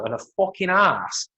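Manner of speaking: ordinary talk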